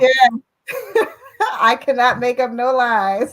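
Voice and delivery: laughing through the words. Real, not acted. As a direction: laugh while insisting it's true. Laughing at the client story.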